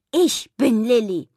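put-on loud, slow, insistent child; emphatic insistence. A narrator's reading. Pippa claiming to be Lilli, emphatically insistent. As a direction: claim the name firmly, word by word.

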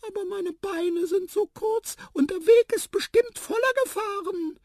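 very high, eager little voice; squeaky mouse. A narrator's reading. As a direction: confide a wish in a mouse squeak. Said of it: "piepste Tiputip". (piepsige Mäusestimme)

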